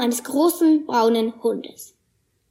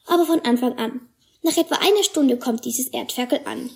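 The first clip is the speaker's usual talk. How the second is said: usual speaking voice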